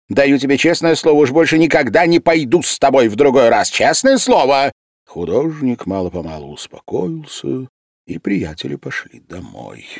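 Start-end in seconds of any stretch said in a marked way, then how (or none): 0.1-4.7 громко, высоко, быстрая горячая клятва; клянись навзрыд, лишь бы увести друга; мольба. A narrator's reading. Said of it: "начал умолять медик". (медик громко и быстро умоляет художника)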